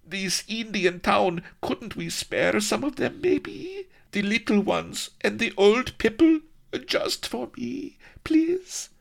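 very high, pleading falsetto; very high, wheedling plea; pleading maiden. Acted. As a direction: beg sweetly for mercy. Consort pleading for the villagers.

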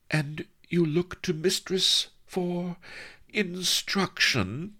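high, slow, drawn-out wonder; high-pitched, hopeful disbelief; awed question. A narrator's reading. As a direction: draw it out high, pausing before the last word. Mistress thrilled by his apparent offer.

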